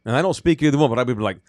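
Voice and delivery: ordinary delivery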